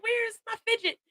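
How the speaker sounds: high, laughing through the words; amused high-pitched where is my fidget; laughing question. Real, not acted. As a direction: giggle while asking. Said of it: "I'm sitting here like".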